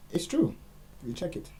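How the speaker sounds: loud, high insistence; high loud emphatic claim. Real, not acted. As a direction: declare it loudly.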